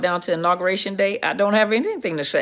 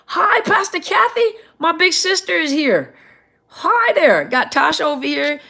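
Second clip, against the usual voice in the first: high-pitched, delighted hello; excited greeting; 10 semitones higher, 4 dB louder than usual. Real, not acted. Livestream host spots big sister joining, excited greeting. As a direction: call out a thrilled welcome, pitch way up.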